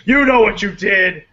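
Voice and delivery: hammed-up furious husband; mock jealous shout. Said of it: "I was like".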